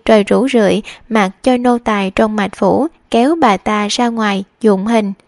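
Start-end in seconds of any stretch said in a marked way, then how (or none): none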